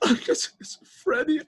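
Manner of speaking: high, sobbed-out imitation of weeping; mock crying. Taxi driver breaks down naming Freddie, crying. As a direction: squeak the words out through pretend sobs. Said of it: "what were you crying about?".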